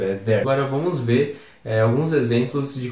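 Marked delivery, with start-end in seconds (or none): 0.2-0.4 THERE as one syllable with no 'ee' sound; THERE without pronouncing the I; correct pronunciation. Shown as a demonstration. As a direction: say THERE simply as 'thair'. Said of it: "Não precisa se pronunciar o I direito".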